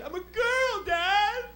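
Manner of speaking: put-on high, whining teen girl; mock teenage whine; daughter's whiny voice. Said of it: "You know what she said?".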